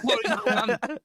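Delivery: high, laughing mock indignation; laughing, hammed-up disbelief; laughing outrage. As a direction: giggle through fake outrage.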